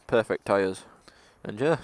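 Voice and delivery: usual speaking voice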